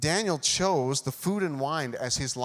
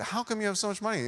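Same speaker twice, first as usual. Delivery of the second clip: higher-pitched imitation of curious girls; girls imitated; 8 semitones higher, faster than usual. Girls asking a teen about his pay, imitated in higher voice. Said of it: "girls used to say".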